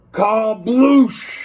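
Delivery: loud, high shout of Kabloosh. Acted. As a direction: yell Kabloosh out as loud as you can. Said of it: "shout it out loud, as loud as you can".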